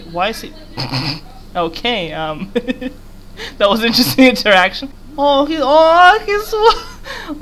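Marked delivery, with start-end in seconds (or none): 5.2-7.4 cooing at cute. Real, not acted. Cooing at cute game character.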